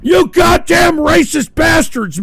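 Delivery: outraged yelling; furious cursing. Real, not acted. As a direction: spit out the insult in outrage. Host cursing the offensive clip.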